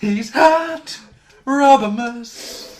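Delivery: loud, high, slow hype call; announcer shout. Pretend. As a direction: announce it like a big reveal.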